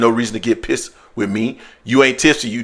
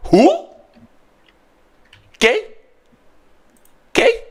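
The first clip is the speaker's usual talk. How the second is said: high puzzled who; imitating puzzled Mexicans. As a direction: mimic the locals who never heard of him. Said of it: "That's what they were saying to me".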